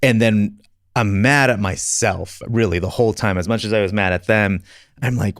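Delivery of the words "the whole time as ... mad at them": normal talking voice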